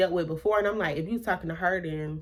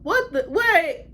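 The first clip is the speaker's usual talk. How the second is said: shocked what; 11 semitones higher, slower than usual. Real, not acted.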